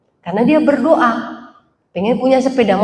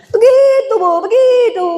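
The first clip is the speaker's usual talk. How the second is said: high, louder put-on voice singing out begitu; mocking attendant approval; 10 semitones higher, 4 dB louder than usual. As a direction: coax like a smug attendant. Parking attendant approving, far voice. (tukang parkir memuji dengan suara dibuat-buat)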